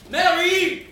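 höher, lauter Hilferuf; verzweifelter Ruf. Acted. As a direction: nach ihr rufen, verzweifelt. Ruft Mary im Weggehen nach. (Melvin ruft Mary verzweifelt nach)

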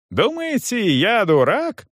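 high-pitched indignant outburst; angry yelling. A narrator's reading. Young man rails at the manager, indignant. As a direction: throw it as an outraged challenge. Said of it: "орал Гирш".

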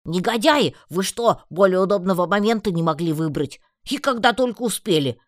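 loud, high, indignant outburst; outraged scolding. A narrator's reading. Heroine berates friends over their bad timing, outraged. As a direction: scold them in exasperated disbelief.

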